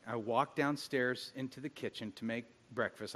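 normal talking voice

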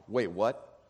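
mock surprise. Villager hearing Lazarus news.

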